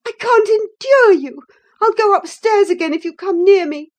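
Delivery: loud, high outburst of revulsion; disgusted cry. A narrator's reading. Woman warns the cousin to keep away, loudly disgusted. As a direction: drive them off with disgust. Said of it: "cried in a tone of disgust".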